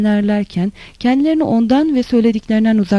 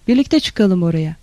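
plain reading voice

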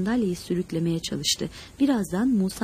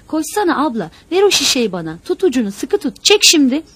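loud, high, urgent commands; panicked shouting for help, building to the end; frantic orders; 5 semitones higher, 8 dB louder than usual. A narrator's reading. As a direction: bark orders in an emergency. Frantic emergency orders in a story.

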